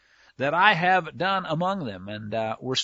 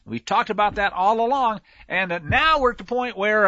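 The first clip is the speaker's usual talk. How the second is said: usual speaking voice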